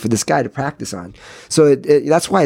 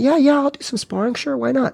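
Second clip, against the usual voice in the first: pitched-up mock innocence; naive eager kid; 13 semitones higher than usual. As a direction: imitate a clueless kid readily agreeing. Retelling naive kid agreeing to spar.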